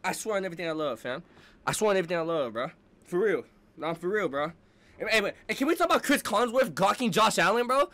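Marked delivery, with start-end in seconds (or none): none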